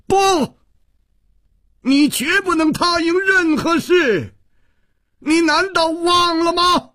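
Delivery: very high-pitched, frantic yelling; desperate high cry of refusal; sudden loud shout. A narrator's reading. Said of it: "忽然大声喝道".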